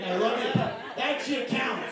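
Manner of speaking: high, loud, slow and laughing; emphatic insistence. Real, not acted. Comic insisting to crowd the love counts, slow and loud. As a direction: drag it out, insisting.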